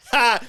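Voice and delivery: sharp loud "Ha!" as if caught off guard; a laugh that escapes before you can stop it; mock burst of laughter. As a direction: bark out a sudden laugh. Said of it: "And I was like".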